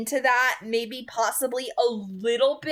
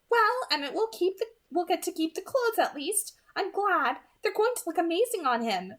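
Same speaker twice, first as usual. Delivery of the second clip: Alice, pleased; 7 semitones higher than usual. Acted.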